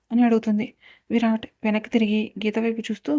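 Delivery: ordinary delivery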